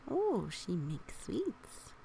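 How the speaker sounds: ordinary delivery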